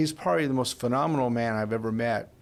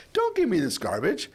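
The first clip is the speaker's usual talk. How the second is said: indignant retort; 7 semitones higher than usual. Retold or imitated. Retold rejection of pastor's answer.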